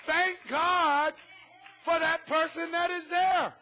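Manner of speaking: loud, fervent preaching. Acted. Pastor preaching to his congregation, loud and fervent. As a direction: preach it out hard to the pews.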